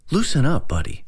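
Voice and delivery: high-pitched start, dropping on the last word; mildly nervous forced cheer. A narrator's reading. Chad tells Edwin to loosen up, nervously. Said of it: "said Chad nervously".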